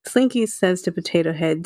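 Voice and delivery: ordinary talk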